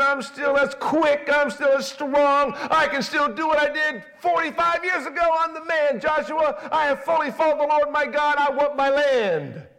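normal talking voice

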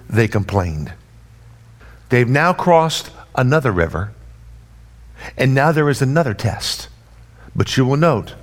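ordinary talk, nothing marked

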